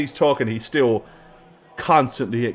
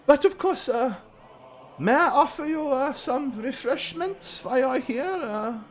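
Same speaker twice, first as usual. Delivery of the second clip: a higher, reverent, oily priest savouring the relic; 13 semitones higher than usual. Acted. GM voices priest character.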